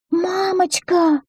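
very high, drawn-out squeak; high, stretched-out child's plea; children's squeal. A narrator's reading. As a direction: squeal it slowly like a pleading child. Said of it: "запищали все четверо".

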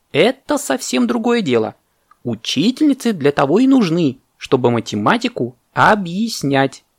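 high indignant insistence; parrot's outcry. Acted. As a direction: insist emphatically, starting high. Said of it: "закричал попугай".

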